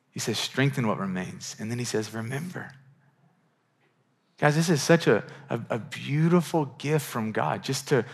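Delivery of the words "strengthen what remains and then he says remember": soft quote. Acted. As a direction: lower and softer, hushed. Quoting Jesus softly.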